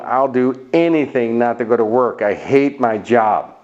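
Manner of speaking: normal talking voice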